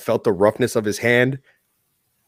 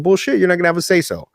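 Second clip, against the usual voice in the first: mock defiant retort; 9 semitones higher, faster than usual. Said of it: "And I said".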